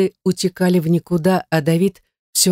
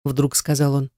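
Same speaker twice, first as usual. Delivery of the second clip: ordinary delivery, nothing marked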